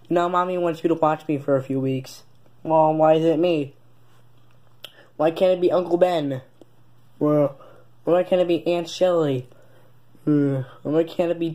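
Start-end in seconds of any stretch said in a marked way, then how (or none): none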